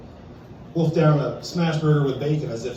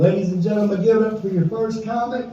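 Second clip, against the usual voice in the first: announcer intro; 6 semitones higher, 4 dB louder than usual. Real, not acted. Host introduces the first comic.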